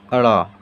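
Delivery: "अड़ा" with a flapped retroflex r, low; letter sound demo. Shown as a demonstration. Teacher models the flapped "अड़ा" for pupils. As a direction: flap the tongue in "अड़ा". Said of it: "इसका उच्चारण हो जाएगा".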